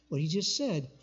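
ordinary talk

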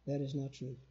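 a soft under-the-breath objection, kept so nobody nearby hears; said softly. Retold or imitated. Nudging his wife during the sermon, softly objecting. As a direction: murmur it so only your wife can hear. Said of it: "I said it real softly".

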